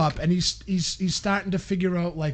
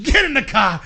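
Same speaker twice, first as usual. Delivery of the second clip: loud, raised-pitch order through laughter; laughing mock order; 5 semitones higher, 7 dB louder than usual. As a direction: order the kid along while cracking up. Retold dad order, laughing.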